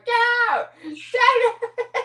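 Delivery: high, loud squawking yell at a brother; bird yelling. Retold or imitated. Parrot mimicking brothers fighting, high loud yell. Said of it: "It's him going".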